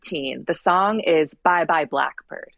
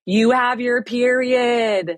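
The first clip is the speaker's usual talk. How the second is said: slow, higher, drawn-out blurt of bad news; blunt announcement; 5 semitones higher, much slower than usual. A stranger blurting out her bad news, slowly and higher. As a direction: spell it out slowly like a tactless kid. Someone in her face going.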